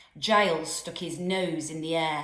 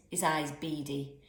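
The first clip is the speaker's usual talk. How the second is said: usual speaking voice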